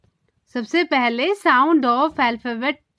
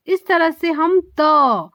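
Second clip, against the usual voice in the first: ordinary talk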